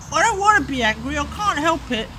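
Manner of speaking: high, rising into a loud, fast wail; a small creature's building distress, pleading; upset little voice. Acted. Little superpower wailing to the lady it doesn't want to be the big boy.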